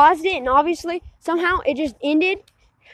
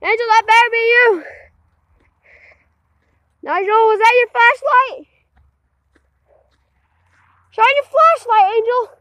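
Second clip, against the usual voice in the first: raised, high voice carrying into the dark; calling out; 4 semitones higher, 4 dB louder than usual. Real, not acted. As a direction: shout anxiously to someone at a distance.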